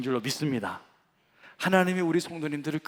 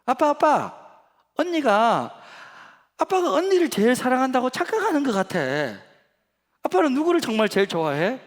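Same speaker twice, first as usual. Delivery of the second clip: put-on high, loud pleading little girl; child voice; 10 semitones higher, 6 dB louder than usual. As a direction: nag Dad like a young daughter. Second daughter asks father. (높고 크게 조르는 어린 딸 목소리)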